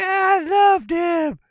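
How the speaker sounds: very high, loud, drawn-out mock wail; screechy lovelorn lady monster; lady Bigfoot. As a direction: wail it slowly like a hammed-up heartbroken girl. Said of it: "the lady Bigfoot's like".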